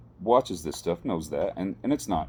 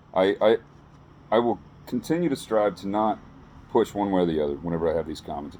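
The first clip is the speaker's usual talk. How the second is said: usual speaking voice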